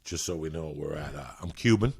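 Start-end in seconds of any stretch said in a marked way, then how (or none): none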